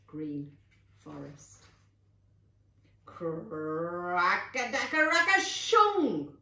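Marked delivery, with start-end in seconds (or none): 3.1-6.4 rolled crack building high and loud; cracking tree crash. A narrator's reading. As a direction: imitate a tree splintering and crashing down. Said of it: "Another tree came down".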